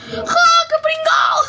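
high, slow jeering laugh-cry; mocking taunt. Real, not acted. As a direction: gloat at the loser. Burla tras algo del juego. (burla aguda y lenta)

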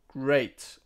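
ordinary talk

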